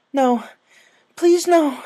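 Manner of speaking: begging for mercy; desperate pleading. A narrator's reading. Rainbow begs her captor, desperate high plea. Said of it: "she pleaded".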